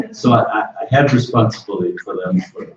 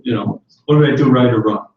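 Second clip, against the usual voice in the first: ordinary talk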